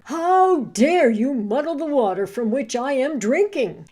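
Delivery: loud, accusing wolf; angry wolf. A narrator's reading.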